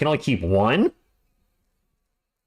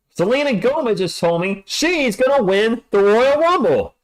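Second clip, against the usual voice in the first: put-on hype voice; 8 semitones higher, slower than usual. Hyping wrestling, put-on voice.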